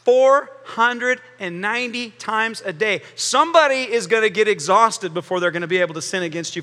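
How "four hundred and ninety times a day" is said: slow, each word spaced, "Four" leaned on hardest; emphatic slow count; stress on four hundred and ninety. Shown as a demonstration. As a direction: spell out "Four hundred and ninety times a day" deliberately. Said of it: "Do the math".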